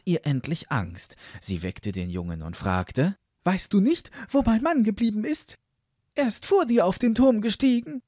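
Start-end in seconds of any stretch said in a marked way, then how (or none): 3.4-8.0 high-pitched worried wife; high, anxious questioning; anxious sexton's wife. Acted. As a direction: ask urgently in a high, put-on voice.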